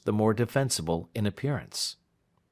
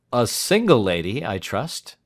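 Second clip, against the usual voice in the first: "single" pitched up and loud; great expression; 3 semitones higher, 6 dB louder than usual. A narrator's reading. Lawyer questioning client about the lady, with great expression. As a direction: lean pointedly on "single", then ease off. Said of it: "with great expression".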